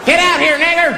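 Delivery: high, strained yell; mock hostile yell. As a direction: holler it as a menacing intruder. Acted hooded man outside the house.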